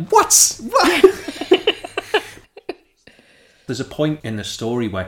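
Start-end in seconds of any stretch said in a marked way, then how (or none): 0.1-1.0 shrieked high and loud in disbelief; incredulous what. Retold or imitated. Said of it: "And you're like". 1.1-2.7 high breathy burst of laughter. Real, not acted. Laughs at own outrage.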